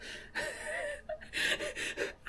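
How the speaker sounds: squeaky suppressed laughter; high, quiet laughing fit. Real, not acted. Retelling the stranger's chat, high wheezy laughter. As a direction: laugh helplessly, high and soft.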